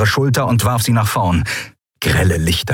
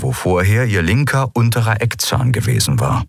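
usual speaking voice

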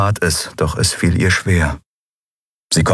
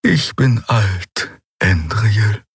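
more serious; 5 semitones higher, much slower than usual. Acted. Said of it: "Dann wurde sie ernster".

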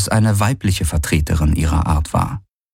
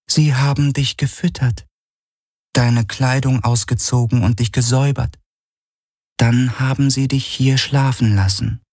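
lightly voiced higher companion; a gentle, higher helper voice; 6 semitones higher than usual. Narrator voices Helix.

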